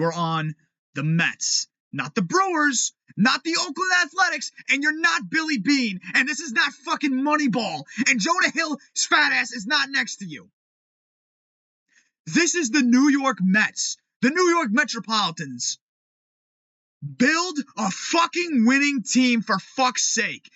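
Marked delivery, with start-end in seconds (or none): 1.9-10.5 angry shout. Real, not acted. Fan ranting at the Mets GM, angry high shout. 12.3-20.5 angry. Real, not acted. Fan scolding the Mets front office, slow angry emphasis.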